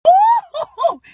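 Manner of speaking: normal talking voice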